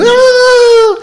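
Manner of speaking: high, loud comic "aah" of a fleeing crowd; mock panicked scream. Everybody running from a crisis, mock panic. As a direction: cry out as if running from chaos. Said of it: "Everybody running".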